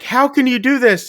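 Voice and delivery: high, pleading imitation of a panicked guest; mock panic. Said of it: "They're all freaking out".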